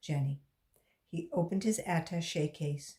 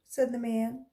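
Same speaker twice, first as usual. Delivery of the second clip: usual speaking voice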